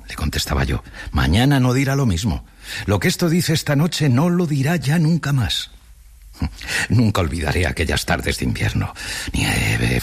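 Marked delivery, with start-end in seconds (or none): 1.1-5.7 higher-pitched, insistent reply, lightly acted; earnest contradiction. A narrator's reading. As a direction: contradict him with rising conviction. Poet contradicts the lazy doctor.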